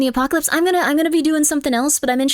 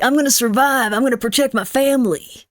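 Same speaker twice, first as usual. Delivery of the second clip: a hint of a Texan survivalist; fake cowboy accent. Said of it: "This is like".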